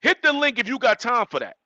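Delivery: ordinary talk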